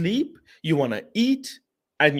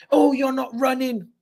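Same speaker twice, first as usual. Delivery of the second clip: higher, lightly jeering fan; mock fan complaint; 9 semitones higher than usual. A hint of a fan moaning at footballers. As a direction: needle the players like a moaning fan. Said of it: "I talk to them like you guys would".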